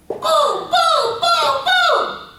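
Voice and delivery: loud, high booming blasts; imitated explosions. Teacher imitating her beliefs crashing after aunt's death, booming. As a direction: boom it out like things crashing down. Imitates a value system collapsing.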